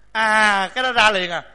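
usual speaking voice

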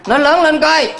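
calling out. Real, not acted. Urging the crowd to answer.

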